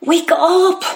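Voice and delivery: will yourself awake with a cry; desperate cry. Acted. Boy urging himself awake as hero leaves, desperate cry.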